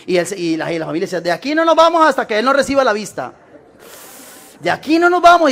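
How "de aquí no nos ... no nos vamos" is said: insistent family. Retold or imitated. Familia insistente voceada en el relato.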